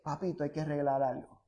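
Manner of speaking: low, soft, quick fatherly word; hushed, lightly voiced God speaking privately; gentle aside. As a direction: drop right down as if confiding. God's gentle aside to believer.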